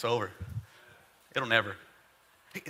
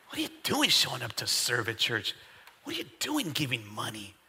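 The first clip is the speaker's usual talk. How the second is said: low sneering devil voice; 7 semitones lower, 5 dB quieter than usual. Acted. Devil taunting churchgoer, low.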